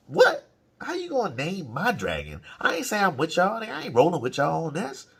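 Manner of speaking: high, loud outraged "What?" then protesting; indignant disbelief. Retold or imitated. Rhaenys protests to the war council, indignant and high. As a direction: act shocked they named her dragon. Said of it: "Rhaenys is in the background like".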